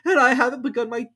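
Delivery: high, near-tearful babble; high, loud tearful Hatter; trembling Hatter. A narrator's reading. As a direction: stammer it out, frightened. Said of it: "in a trembling voice".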